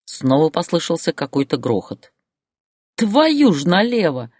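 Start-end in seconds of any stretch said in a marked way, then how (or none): 3.0-4.3 high, drawn-out exasperated yell. A narrator's reading. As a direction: holler the curse down the phone. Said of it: "заорал он в трубку". (орёт в трубку)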